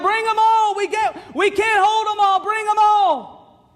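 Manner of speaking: high-pitched, urgent holler; excited calling out. Acted. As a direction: call out high, stretching the final words. Peter calling for all the nets.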